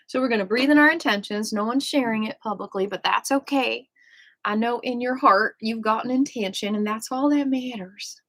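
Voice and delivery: normal talking voice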